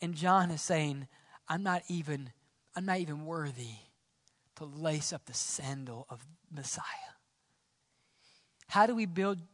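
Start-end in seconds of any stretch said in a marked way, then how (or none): 1.5-6.8 low, soft and humble; hushed humility. Acted. John humbly saying he is unworthy, hushed humility. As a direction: lower your voice, almost fading away.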